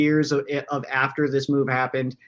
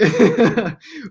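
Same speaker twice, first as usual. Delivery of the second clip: loud laugh; 8 semitones higher, 7 dB louder than usual. Real, not acted. Laughing at the light.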